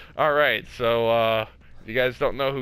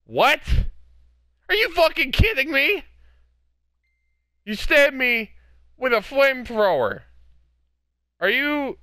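outraged disbelief; 8 semitones higher than usual. Real, not acted. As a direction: shout at the absurd attack. Stabbed by flamethrower.

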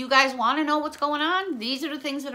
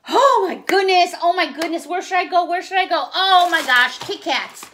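high, loud, giddy exclamations; excited gushing; 6 semitones higher, 6 dB louder than usual. Real, not acted. Shopper overcome at finding Kit Kats, excited gushing. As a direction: gush in a raised voice over a thrilling find.

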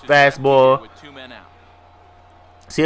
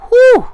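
high relieved whew; 9 dB louder than usual. Real, not acted. Relief after escaping the jam.

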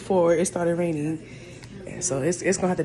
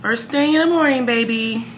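louder and higher for emphasis; emphatic repeat; 4 semitones higher, 6 dB louder than usual. Real, not acted. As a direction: punch the repeat home. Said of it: "repeats louder".

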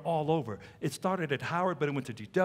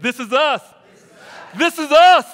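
loud, high, emphatic "This is us", louder on the repeat; like you mean it; 9 semitones higher, 14 dB louder than usual. Shown as a demonstration. Preacher showing the congregation how to say it, shouting like they mean it. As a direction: shout "This is us" with full conviction. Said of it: "Say it like you mean it".

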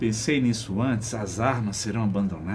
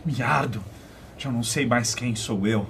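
ordinary talk

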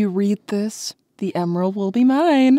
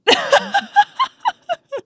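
high, bright teasing giggle; teasing laugh. Real, not acted. Rouge laughing at Knuckles after tricking him.

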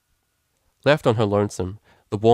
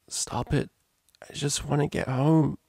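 mild plaintive plea; 6 semitones higher than usual. A narrator's reading. Drunk, dizzy, alone, wanting home.

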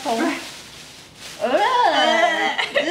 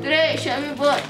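cheeks stuffed full; 4 semitones lower than usual. Real, not acted. Three marshmallows in mouth.

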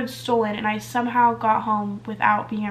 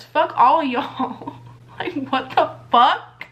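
slow, drawn-out disgusted curse; disgusted dismissal; much slower than usual. Real, not acted. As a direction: drag it out like you're done with them. Angry about being robbed, mock curse.

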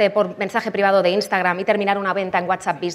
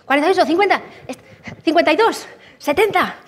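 excited counting up; 8 semitones higher, 3 dB louder than usual. Retold or imitated. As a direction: voice climbing high, excited. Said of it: "era como".

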